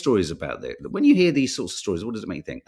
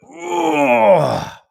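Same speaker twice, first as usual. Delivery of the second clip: loud rising "Oh" groan, much higher; getting-up groan; 14 semitones higher, 9 dB louder than usual. Shown as a demonstration. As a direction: groan as you heave yourself up. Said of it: "when I get up, I go".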